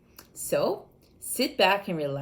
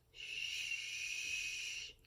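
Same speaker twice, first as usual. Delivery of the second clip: breathy hush; whispered shush; 15 dB quieter than usual. Acted. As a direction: shush them without voice.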